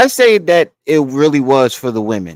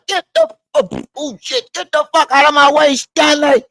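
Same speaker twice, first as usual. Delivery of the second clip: mock yell; 10 semitones higher than usual. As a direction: shove everyone aside, yelling high. Bishop shout again, building.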